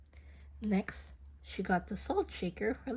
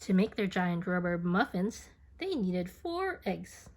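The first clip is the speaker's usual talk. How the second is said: ordinary delivery, nothing marked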